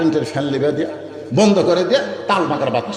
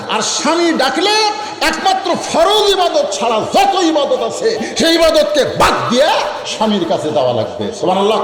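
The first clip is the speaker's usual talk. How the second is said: preaching at full pitch; 10 semitones higher than usual. Real, not acted. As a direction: drive the ruling home to the crowd, voice soaring. (উচ্চস্বরে আবেগী ওয়াজ)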